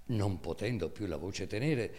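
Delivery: usual speaking voice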